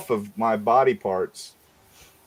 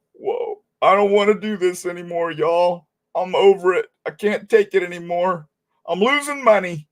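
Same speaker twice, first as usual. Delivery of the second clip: whiny complaint; 8 semitones higher than usual. Retold or imitated. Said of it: "Do you think Dago would be going".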